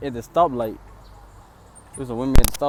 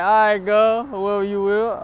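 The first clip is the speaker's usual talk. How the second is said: mock sweet reply; 7 semitones higher, 4 dB louder than usual. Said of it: "I'm like".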